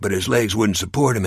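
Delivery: usual speaking voice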